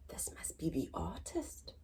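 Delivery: breathy whispered aside after a gasp. Shown as a demonstration. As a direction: share it like a secret. Gasp then secret aside.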